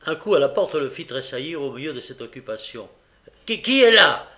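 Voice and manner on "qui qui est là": much higher, loud startled shout; frightened cry. A narrator's reading. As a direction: jump at the knock and cry out. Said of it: "s'écria-t-il vivement et avec effroi".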